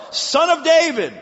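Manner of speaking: high loud call of "Son of David"; crying out. Acted. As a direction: call out with all your strength. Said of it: "He cried out all the more".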